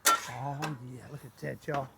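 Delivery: low, very soft appreciation; hushed admiration. Real, not acted. Cook showing viewers the pot, hushed admiration. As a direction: drop low and soft, savoring it.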